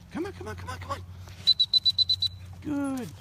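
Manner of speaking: very high squeaky coaxing voice; sing-song high call to a dog; high-pitched dog praise. Real, not acted. Calling dog back.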